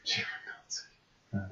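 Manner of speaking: slow, breathy whispered name. Pretend. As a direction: hush it out slowly. Echoing name in mock voice.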